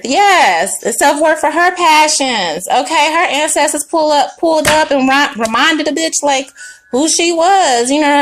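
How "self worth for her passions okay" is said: ordinary delivery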